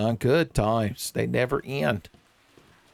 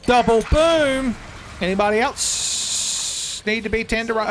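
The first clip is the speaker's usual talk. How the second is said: excited yell; 9 semitones higher than usual. Real, not acted. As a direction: gloat over the hit like a showman. Blasting enemies in game.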